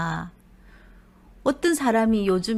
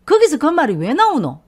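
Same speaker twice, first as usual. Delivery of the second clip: higher, louder snapping retort; sharp retort; 5 semitones higher, 8 dB louder than usual. Acted. Husband retorting to his wife's jab, loud sharp retort. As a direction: snap the question back at her.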